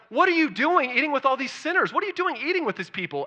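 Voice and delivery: high-pitched, indignant questioning; mock freaked out. Religious leaders challenging Jesus over dinner with sinners, mock freaked out. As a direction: imitate scandalized onlookers demanding an explanation. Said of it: "They freaked out too".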